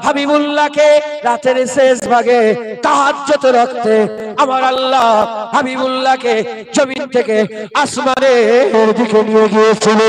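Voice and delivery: a waz preacher intoning to the gathering; fervent preaching. Acted. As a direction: swell it for the crowd at the jalsa. Preacher builds on the night prayer.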